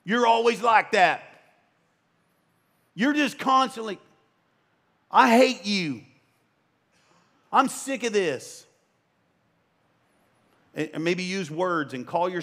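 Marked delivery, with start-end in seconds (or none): 0.0-8.7 loud, high mock marital fight; spouse fighting. Spouse shouting at their partner in a quarrel, loud and bitter. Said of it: "constantly saying things".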